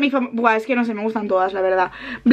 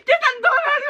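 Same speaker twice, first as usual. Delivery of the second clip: high excited shout. Real, not acted. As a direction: cry it out in joy. Excited the album is out.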